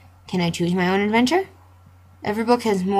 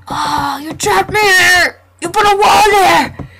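high, loud, anguished yell; outraged shout; 9 semitones higher, 7 dB louder than usual. Real, not acted. Player yelling at the game for walling him in, outraged. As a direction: cry out against being trapped.

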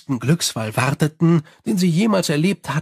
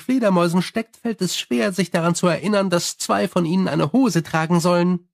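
Sally; 3 semitones higher than usual. Acted. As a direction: rattle on cheerfully like a gossiping vampire. Sally the vampire speaks.

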